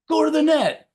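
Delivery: high, loud call to the player; mock coach shout. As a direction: yell it across the court. Tennis coaches shouting from box.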